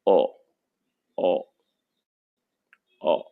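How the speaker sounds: ओ said as the short vowel of hot; ओ sounded like the o in box; teacher drills ओ. Shown as a demonstration. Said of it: "अगला जो हमारा vowel है".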